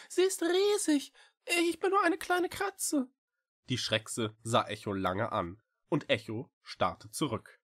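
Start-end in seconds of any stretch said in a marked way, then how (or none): 0.1-3.1 high, pleading little cat; small cat voice. A narrator's reading. Echo pleading with the witch, high little cat.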